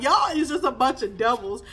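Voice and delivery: ordinary talk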